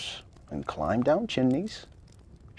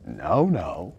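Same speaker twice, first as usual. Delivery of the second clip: lower, kindly Santa voice; deep jolly Santa; 10 semitones lower than usual. A narrator's reading. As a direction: soothe her as a jolly old Santa. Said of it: "said a deep, jolly voice".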